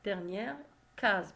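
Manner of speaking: ordinary delivery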